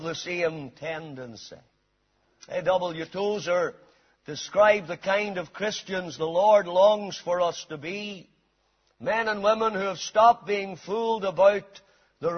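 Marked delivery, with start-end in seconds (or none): none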